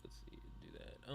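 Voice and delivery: unvoiced whisper to herself. Real, not acted. Streamer whispering to herself while working the video, hushed. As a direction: breathe it under your breath.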